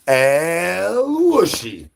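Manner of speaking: high drawn-out call. Acted.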